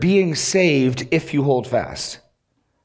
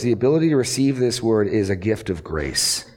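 usual speaking voice

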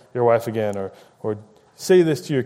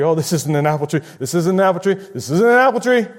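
mock denial; 4 semitones higher, faster than usual. Preacher mocking a sinner in denial, rising to a shrill insistence. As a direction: insist harder every time it's said.

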